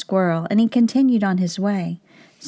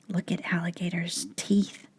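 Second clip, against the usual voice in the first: much softer whispered aside; 9 dB quieter than usual. Shown as a demonstration. Teacher whispering to the class, much softer. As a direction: lean in and whisper it. Teacher hushes to point at teeth.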